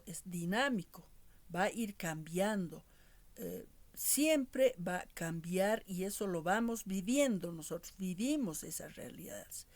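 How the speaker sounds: ordinary talk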